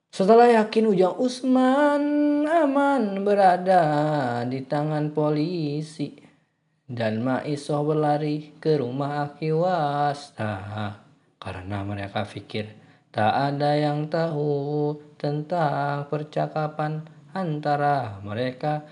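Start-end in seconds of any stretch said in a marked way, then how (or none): 0.1-10.5 loud, slow, drawn-out storytelling; narasi dramatis. A narrator's reading. 13.1-18.8 slow, drawn-out suspenseful narration; narasi dramatis. A narrator's reading.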